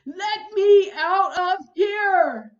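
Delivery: very loud, slow hysterical shriek. Acted. Terrified neighbor shrieking to escape the house. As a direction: wail to be let out. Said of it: "Missus Hipple shrieked on".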